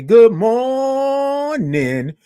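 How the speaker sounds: long sung-out "good morning" drawn high and slow; drawn-out morning show greeting; stretched good morning. Shown as a demonstration. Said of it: "You know I love all those O's and R's".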